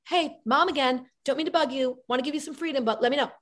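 high, apologetic mom voice; mom calling again. Acted. Mom phoning college kid late at night, high tentative check-in. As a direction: pitch it up, trying not to be a bother.

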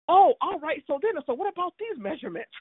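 mimicking Eric waving off the coughing; mock breezy Eric. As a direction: ham up his carry-on cheeriness. Mocking Eric's business-as-usual act.

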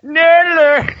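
high, loud cry; nerd alert cry. Shown as a demonstration. Host showing how he says it daily, loud and high. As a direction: belt it like a familiar alarm. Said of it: "how many times a day I say that".

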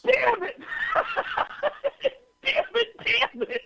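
high, laughing mock curse; high comic damn it; mock frustrated curse. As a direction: curse your luck in a high mock wail. Retold inner frustration.

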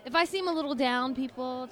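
ordinary talk, nothing marked

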